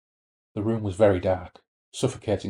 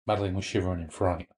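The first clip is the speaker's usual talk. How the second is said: usual speaking voice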